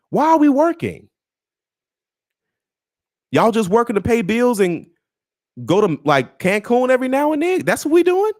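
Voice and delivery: high, mock-incredulous questions; disbelief, voice jumping way up. Real, not acted.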